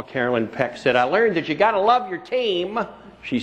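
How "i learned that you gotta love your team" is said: imitating a Tennessee drawl, pitched higher; a light Tennessee drawl for the coach's quote. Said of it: "in that wonderful Tennessee drawl".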